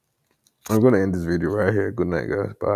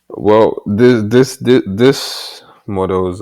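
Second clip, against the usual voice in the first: loud exasperated; 8 dB louder, much slower than usual. Real, not acted. Exasperated at slow model.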